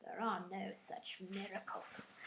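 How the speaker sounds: breathy whispered put-down; whispered deadpan. Retold or imitated. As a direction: whisper it flatly. Spock dismissing the miracle.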